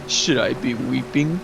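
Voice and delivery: slow, theatrical villain taunt; Orochimaru. Retold or imitated. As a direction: draw out each phrase with mock sorrow. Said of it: "And Orochimaru is like".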